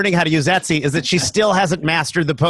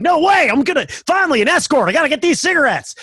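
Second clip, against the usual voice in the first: mock Southern driver; 10 semitones higher, faster than usual. Southern drivers racing an ambulance.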